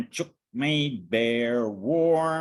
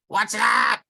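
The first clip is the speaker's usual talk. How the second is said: high, loud startled squawk; squawking hen; 9 semitones higher, 6 dB louder than usual. Acted. Chook hears a train and squawks in alarm. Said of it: "squawked Chook".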